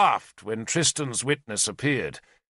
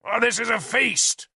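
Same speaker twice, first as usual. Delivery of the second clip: high, indignant protest; 12 semitones higher, 3 dB louder than usual. Acted. Owain protesting the trial at the feast, high and indignant. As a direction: scoff at the very idea. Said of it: "Owain protested".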